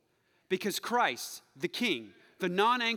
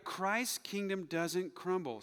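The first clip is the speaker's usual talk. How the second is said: ordinary talk, nothing marked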